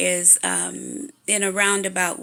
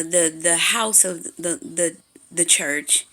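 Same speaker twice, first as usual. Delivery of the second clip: stumbling, repeating words; stuttering. Real, not acted. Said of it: "I'm stuttering 'cause I'm just".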